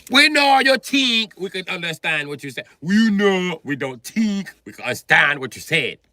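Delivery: loud, high mock African accent. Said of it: "they like Africans who you can understand".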